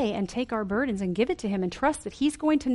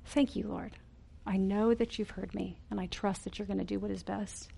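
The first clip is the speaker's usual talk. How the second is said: softer, calm, confident thanks; trusting prayer; 8 dB quieter than usual. Shown as a demonstration. Preacher demonstrating a thankful prayer, soft and trusting. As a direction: settle into quiet trust. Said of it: "Thanksgiving is a way of showing that you are trusting".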